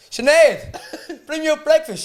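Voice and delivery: mock yell, high and loud; calling out. Boyfriend yelling for breakfast to deaf girlfriend downstairs, calling out. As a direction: holler her name through the house.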